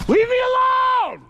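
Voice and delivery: high, desperate scream; terrified scream. Real, not acted. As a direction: scream for them to leave you alone. Screaming at pursuers.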